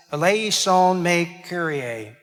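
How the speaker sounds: slow, careful Greek "eleison me Kyrie"; teaching the Greek "eleison me Kyrie" slowly; Greek pronunciation. Shown as a demonstration. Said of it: "The Greek is".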